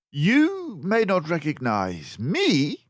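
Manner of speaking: cool and unruffled; calm detective. Acted. Basil calmly facing his captors. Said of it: "declared Basil calmly".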